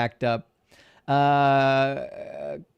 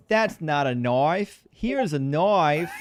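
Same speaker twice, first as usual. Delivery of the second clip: very slow, drawn-out Aussie movie line; Australian accent; much slower than usual. Acted. Streamer quoting the only line he knows, exaggerated Australian accent. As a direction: stretch "knife" into broad mock-Australian "noif". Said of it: "The only line I know is".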